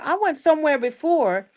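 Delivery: ordinary delivery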